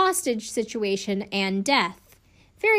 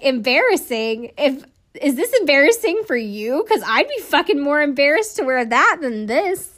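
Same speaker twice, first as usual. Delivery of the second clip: louder, offended comeback; indignant retort; 5 semitones higher, 6 dB louder than usual. Retold or imitated. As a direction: throw her words back at her, offended. Inmate Eileen snaps back, offended.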